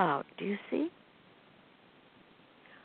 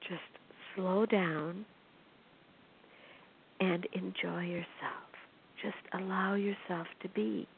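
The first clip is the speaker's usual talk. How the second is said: hushed calming; 2 semitones lower, 10 dB quieter than usual. Real, not acted. Soothing listeners to calm down.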